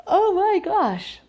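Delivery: slow, loud, high gasp of delight; real surprise. Real, not acted. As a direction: stretch out the reader's own exclamation. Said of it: "reader's own reaction".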